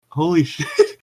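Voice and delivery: breaking into laughter mid-phrase; real laugh splitting the curse. Real, not acted. Laughing at her own slip.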